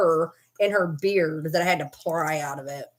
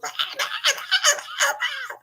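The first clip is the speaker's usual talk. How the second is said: imitated dog yelp, very high; high squealing yelp. Mimicking the dog's yelp when the pack attacked. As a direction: squeal like a dog getting bitten.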